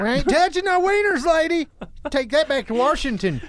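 high-pitched comic refusal; hammed-up folksy indignation; mock-indignant trooper. Acting out troopers refusing her order.

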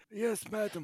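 high put-on fancy butler. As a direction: stiff servile butler. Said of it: "my fancy butler voice".

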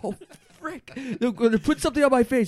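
panicked. Acted. Panicking about the ex-husband.

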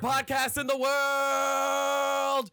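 drawn-out, high, shouted showman announcement; ringmaster. Pretend. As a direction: stretch every word like a big-top barker. Circus-style podcast intro.

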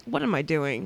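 low, soft muttered question; quiet self-questioning. Retold or imitated. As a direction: ask yourself under your breath. Questions herself in retold story.

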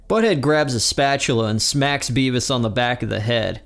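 ordinary talk

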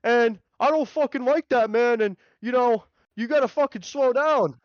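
lightly voiced pissed-off pedestrian scolding the driver; imitating the pedestrian. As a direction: driver mimics the man who scolded him. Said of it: "he goes".

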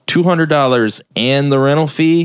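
mock-incredulous repeat, leaning on "and". Shop owner repeats Bill's offer back, mock incredulous. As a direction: imitate checking an offer too good to believe.

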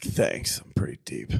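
breathy mock-deep whisper; whispered self-mockery. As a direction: whisper it like a pretentious kid. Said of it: "I was like".

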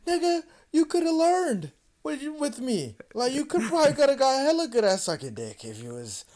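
high, loud exasperated protest; retold indignant outburst. Arguing back at his girlfriend's excuse, indignant. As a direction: argue back in high-pitched disbelief. Retold heated argument with her.

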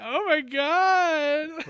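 high, loud, very drawn-out gasp of words; scandalized wail, stretched right out; mock shock. Host reliving reaction to Victor's insult, scandalized.

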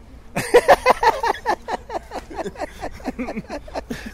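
shrieking burst of laughter; high squealing laugh. Real, not acted. Big laugh at joke.

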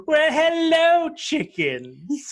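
high, slow, camp English drawl; over-the-top posh English "Well, hello"; English accent. Retold or imitated. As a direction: greet them like a flamboyant British historian. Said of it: "he's got this English accent and he's just sort of like".

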